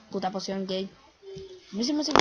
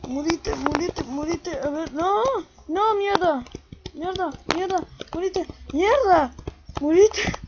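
cursing under breath; 6 semitones higher, 4 dB louder, slower than usual. Real, not acted. Fighting monster, cursing.

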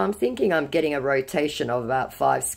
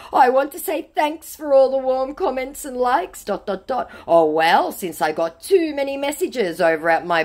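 high put-on fawning voice; mock a scammer buttering up a fan; gushing scammer; 7 semitones higher, 3 dB louder than usual. Scammer thanking his supposed fan, high mock gushing. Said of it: "said our scammer".

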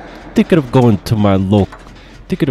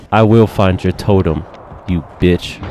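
ordinary delivery

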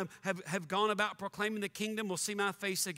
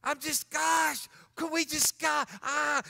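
higher, louder, slow frustrated sputter; sputtering exasperation; 5 semitones higher, 3 dB louder, slower than usual. Real, not acted. Preacher too angry for words, sputtering shout. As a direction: choke on your frustration.

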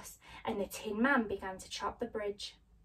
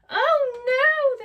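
high, loud alarmed cry; 12 dB louder than usual. Acted. Scarecrow cries out seeing friends asleep. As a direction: shout in dismay. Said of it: "the Scarecrow cried".

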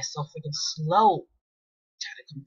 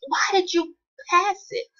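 incredulous shout; 13 semitones higher, 3 dB louder than usual. Real, not acted. Teammate made a bad pass.

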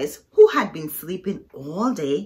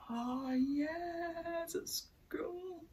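hushed; 9 semitones higher, 16 dB quieter, much slower than usual. Real, not acted. Storyteller hushed aside.

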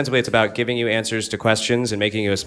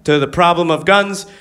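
a hint of a smug NRA spokesman, higher pitch; cheerful salesman pitch, pitched up; 7 semitones higher, 3 dB louder than usual. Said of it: "And it's like".

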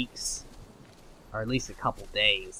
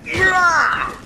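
very high, very loud battle yell; triumphant scream; 14 dB louder than usual. Real, not acted. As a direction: scream it as the blow lands. Landing a hit in fight.

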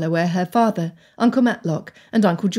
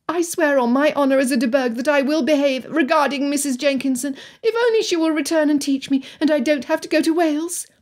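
high-pitched, earnest oath; earnest Anne, higher voice throughout; solemnly swearing Anne; 7 semitones higher than usual. Acted.

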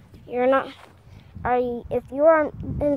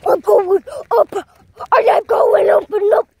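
excited play voice; 5 semitones higher, 10 dB louder than usual. Pretend. Playing with toy truck.

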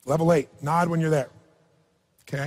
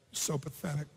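much softer, sinking repeat; echoing self-pity; 4 semitones lower, 15 dB quieter than usual. Retold or imitated. Coach repeating the woman's self-pity to the audience, much softer. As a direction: let it fall away to almost nothing. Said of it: "Is there a tone of voice here".

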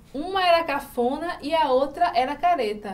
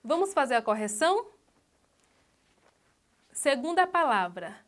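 normal talking voice

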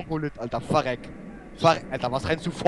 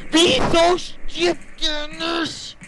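slow, high, drawn-out rage scream; losing it, screaming at the game; freak-out scream; 8 semitones higher, 3 dB louder, much slower than usual. Real, not acted. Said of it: "ich bin ja schon ausgerastet".

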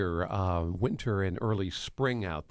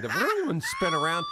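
vroom noise. Retold or imitated. Imitating a car U-turn.

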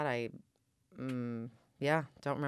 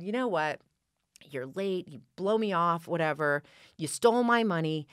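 angry confrontation; 4 semitones higher, 5 dB louder than usual. Retold or imitated. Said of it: "I finally just said".

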